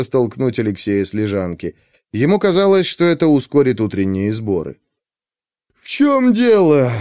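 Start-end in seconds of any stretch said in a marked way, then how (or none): 5.8-6.9 words through a yawn; sleepy, mid-yawn question. Acted. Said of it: "позёвывая, спросил Лёша". (спросонья, позёвывая)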